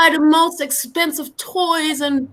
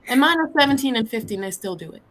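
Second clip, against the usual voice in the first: ordinary talk, nothing marked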